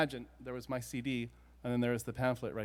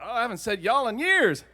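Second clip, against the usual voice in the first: high, loud burst of amazement; excited realization; 9 semitones higher, 11 dB louder than usual. Retold or imitated. Acts out surprise at own accent.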